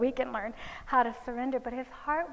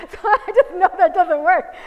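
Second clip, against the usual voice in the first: loud, high laughing through the words; 7 semitones higher, 14 dB louder than usual. Real, not acted. Retelling a parent's complaint about the talk, laughing loudly. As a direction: crack up while quoting it.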